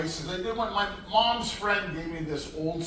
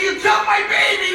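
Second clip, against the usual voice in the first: much higher, loud, hysterical yell; yelling mother; 11 dB louder than usual. Retold or imitated. Mother screaming at him over her daughter, loud high yell. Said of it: "The mother's yelling at me".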